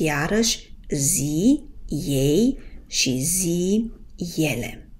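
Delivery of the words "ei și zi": usual speaking voice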